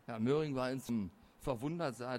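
ordinary delivery, nothing marked

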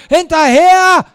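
very loud, high battle command; full-force drawn-out yell; general's bellow. A narrator's reading. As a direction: roar it like a general ordering a charge. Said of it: "brüllte Lionel wie ein General".

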